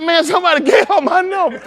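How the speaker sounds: laughing. Retold or imitated.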